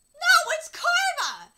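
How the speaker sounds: huge, high, drawn-out yell; gleeful heckling shout. Pretend. Reader heckles a character asking about curses, shouting. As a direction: bellow the answer at the characters.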